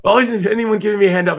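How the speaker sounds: hammed-up Aussie quote; horrible Australian accent. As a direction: have another go at a shaky Australian accent. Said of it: "this is my horrible Australian accent".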